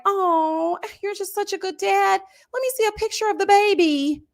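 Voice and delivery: imitating a fawning girlfriend; mock sweet girl voice. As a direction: play her as a starry-eyed fan of his. Girl fawning over the cheater.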